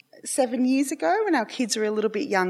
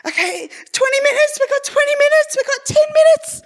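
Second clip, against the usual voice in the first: much higher, louder frantic countdown; impatient husband counting down; 7 dB louder than usual. Retold or imitated. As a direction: count down the minutes in a panic. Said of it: "my husband's there, like".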